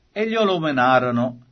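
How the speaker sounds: usual speaking voice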